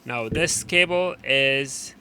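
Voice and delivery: ordinary talk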